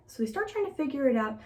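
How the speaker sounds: usual speaking voice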